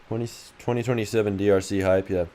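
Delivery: usual speaking voice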